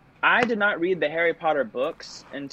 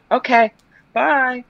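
high, louder, hurried exit; mock-flustered, pitched-up farewell; flustered goodbye; 11 semitones higher, 5 dB louder, slower than usual. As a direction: blurt it higher as you flee. Flustered exit from audition, acted.

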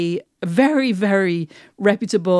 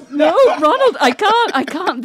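high, loud laughing refusal; laughing protest; 8 semitones higher, 4 dB louder than usual. Real, not acted. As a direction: protest through laughter, voice shooting up. Laughing refusal to do American accent.

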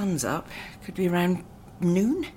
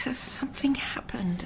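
hushed whisper; 5 dB quieter than usual. Acted. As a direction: whisper it, uneasy. Captives speaking quietly.

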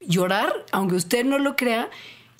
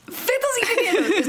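amused; 14 semitones higher than usual. Real, not acted. Repeating the odd phrase, amused.

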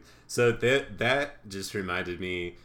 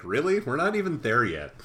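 put-on higher, louder kvetching old lady; 6 semitones higher, 4 dB louder than usual. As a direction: complain like a fussy grandmother.

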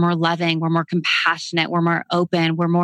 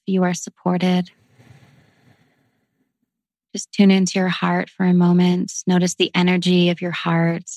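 guided meditation. Acted. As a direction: lead them gently into the breath. Leads a breathwork meditation.

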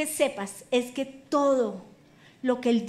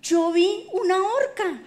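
high, slow, drawn-out falsetto; comic timid-servant imitation, high throughout; put-on eunuch voice; 6 semitones higher, 4 dB louder, slower than usual. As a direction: tell on someone in a slow, high singsong. Eunuch's voice, acted.

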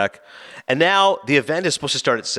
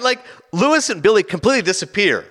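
normal talking voice

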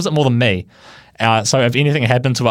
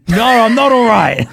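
high, louder retold yell; frantic retort, acted out for the story; exasperated outcry; 9 semitones higher, 5 dB louder than usual. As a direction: yell back in mock panic. Retold panicked reply to a driver.

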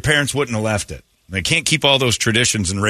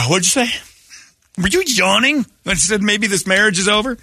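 mock yawning exchange; 12 semitones higher than usual. Husband and wife act-out.

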